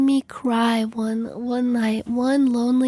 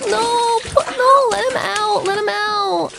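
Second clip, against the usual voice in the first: high-pitched, urgent exclaiming; distressed pleading; 5 semitones higher than usual. Real, not acted. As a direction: plead for the cat's release. Pleading for the bathed cat.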